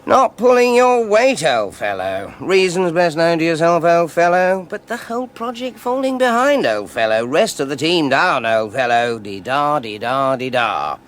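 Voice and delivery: high, loud, pompous mimicry of the boss; mocking the head. [name] mocking the head to David, in a high, loud pompous voice. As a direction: lampoon his patronising pep talk. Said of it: "Was that all he said?".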